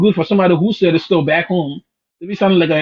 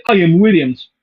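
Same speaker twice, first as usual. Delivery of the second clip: put-on African accent for a mocking quote; 4 dB louder than usual. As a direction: imitate a faked African accent, amused. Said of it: "talking an African accent".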